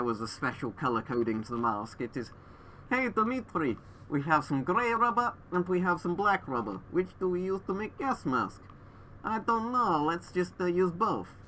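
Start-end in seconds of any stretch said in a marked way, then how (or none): 2.9-11.3 put-on high, hammy Russian accent; fake Russian accent. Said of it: "a really fake Russian accent".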